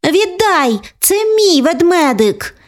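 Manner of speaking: put-on high child voice, demanding; a small girl snatching her teddy back; little girl grabbing. A sister demanding her teddy bear back, high childish voice.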